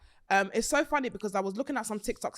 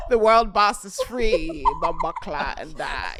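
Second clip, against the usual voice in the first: put-on slow, loud, laughing Jamaican accent; mocking a friend's slip into a Jamaican accent; 10 dB louder, slower than usual. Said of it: "doing an accent".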